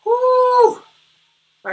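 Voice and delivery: whooping all right; loud cheer. Real, not acted. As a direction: shout it out, high and loud. Cheers after finishing the set.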